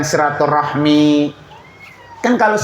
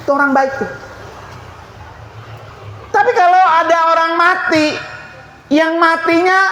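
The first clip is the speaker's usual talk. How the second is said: higher, emphatic sermon voice; fervent preaching; 8 semitones higher than usual. Acted. Preacher contrasts good and bad deaths, preaching fervently. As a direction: drive the moral home to the congregation.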